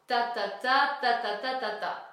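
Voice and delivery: loud, even run of "ta"; rhythmic syllables. Shown as a demonstration. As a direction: tap out each "ta" rhythmically. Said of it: "remplacer notre texte par ta".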